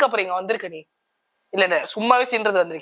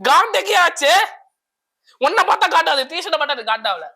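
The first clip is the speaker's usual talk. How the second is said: irritated retort; 7 semitones higher than usual. Acted.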